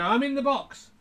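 high-pitched dummy call; ventriloquist's dummy. Acted. Performer turns round to be the dummy, high call. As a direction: pipe up high as the hidden dummy. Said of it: "I'd turn around and go".

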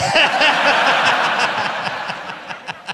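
loud, high airless laugh; helpless wheeze of laughter; squeaky breathless laugh; high wheezing laugh. Real, not acted. Laughing at the tweet.

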